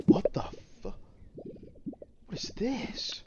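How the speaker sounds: breathless whisper, rising to a high what is this; whispered astonishment. Real, not acted. Viewer startled by a weird bird call, whispering in disbelief. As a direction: hush it out, stunned.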